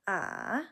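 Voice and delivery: "ah" dipping down then back up; full third tone. Shown as a demonstration. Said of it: "you dip down, bounce back up".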